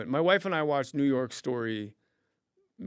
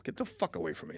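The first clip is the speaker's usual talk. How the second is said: muttered brush-off; 8 semitones lower, 8 dB quieter than usual. Retold or imitated. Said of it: "I'm like".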